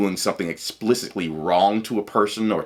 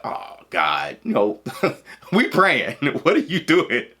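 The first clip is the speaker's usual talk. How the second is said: laughing mock annoyance; 6 semitones higher than usual. Annoyed thought while kneeling praying.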